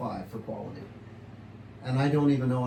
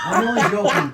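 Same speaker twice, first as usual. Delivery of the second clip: loud and higher, laughing through the words; 8 semitones higher, 10 dB louder than usual. Real, not acted. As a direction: laugh out the apology. Laughing after his own joke.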